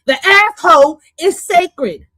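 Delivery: a light take on Cat Williams, drawn out; Cat Williams impression. To her audience, a light Cat Williams impression, slow. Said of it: "Now I sound like fucking Cat Williams".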